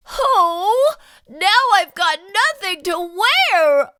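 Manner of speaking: groaning. Acted. Splat moaning about his ruined costume, a mock groan. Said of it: "Splat groaned".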